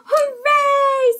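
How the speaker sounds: high loud hooray; excited cheer. Acted. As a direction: cheer it out gleefully.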